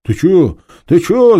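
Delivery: high, loud whimpering protest; scared thief whining at the inspector. Acted. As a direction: whine in panic like a cornered thief. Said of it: "заскулил Пашка".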